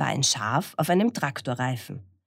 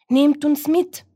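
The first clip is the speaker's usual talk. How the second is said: high, loud rooster-and-hen call; 12 semitones higher, 6 dB louder than usual. Acted. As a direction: cry out to be rescued. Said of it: "riefen Hahn und Henne".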